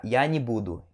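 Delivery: не with an i vowel, run into буду. Shown as a demonstration. Teacher shows everyday speech, не pronounced "ni". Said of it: "você pronuncia como i".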